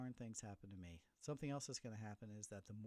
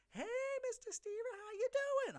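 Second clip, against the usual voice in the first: chirpy principal; 9 dB louder, slower than usual. Retold or imitated. Assistant principal greets him cheerfully.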